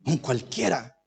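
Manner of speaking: low, soft jab of mock indignation; hushed, acted indignant woman; indignant wife. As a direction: play the scornful wife under your breath. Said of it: "voy a hablar como mujer indignada".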